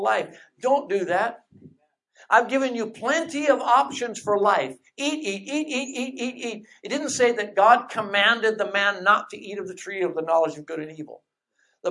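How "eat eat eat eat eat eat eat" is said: gentle urging. Pretend. God urging again.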